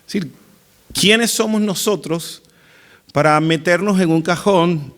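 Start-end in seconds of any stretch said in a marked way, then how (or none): none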